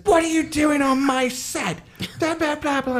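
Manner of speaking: hammed-up territorial outburst; retold yelling. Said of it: "he just started yelling".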